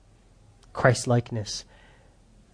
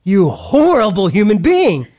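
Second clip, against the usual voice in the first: higher, louder scornful judgment; judging look; 6 semitones higher, 6 dB louder than usual. Retold or imitated. Pastor voicing a stranger's scornful glare at him. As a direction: sneer at a bad parent. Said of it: "the look on her face was like".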